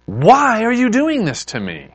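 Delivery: high-pitched exasperated protest; aggrieved, imploring outburst; anguished frustration. Acted. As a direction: cry out to God in acted frustration. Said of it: "pouring out some anger and frustration".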